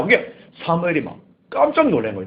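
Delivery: ordinary talk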